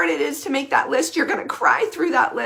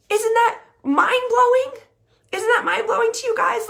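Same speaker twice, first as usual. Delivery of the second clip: amazed; 10 semitones higher, 3 dB louder than usual. Real, not acted. Amazed at her point.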